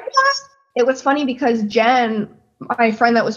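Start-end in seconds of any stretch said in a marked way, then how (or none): 0.0-0.4 much higher, quick stunned What; imitating stunned What. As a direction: squeak it out in disbelief. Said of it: "He's like".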